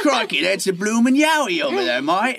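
over-the-top mock-Australian excitement; Australian accent. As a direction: exclaim like a cartoon Australian bushman. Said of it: "you always sound Australian".

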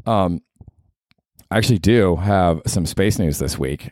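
usual speaking voice

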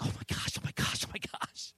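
breathless starstruck whisper; hushed giddy oh my gosh; inner whisper. Retold or imitated. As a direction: whisper it like you can't believe your eyes. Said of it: "inside I'm like".

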